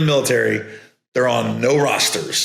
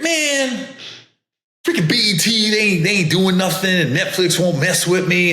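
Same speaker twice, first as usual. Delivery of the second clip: mock frustrated comic; 6 semitones higher than usual. Comedian mocking struggling comics' complaints, high hammed-up griping. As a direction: vent like a comic nobody will book. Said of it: "when you're frustrated in your career, you'd be like this".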